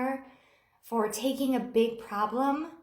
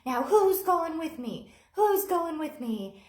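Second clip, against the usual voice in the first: dramatic exit; 6 semitones higher, 4 dB louder than usual. Retold or imitated. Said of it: "He makes this really dramatic exit".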